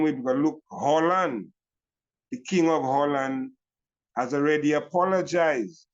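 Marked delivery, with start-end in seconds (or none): none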